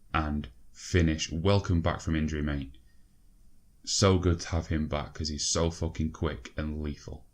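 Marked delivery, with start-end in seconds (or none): none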